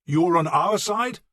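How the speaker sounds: slow, loud, high question; drawn-out shouted query. Acted. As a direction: call it out slowly, as if doubting him. Calling to enemy lord in battle.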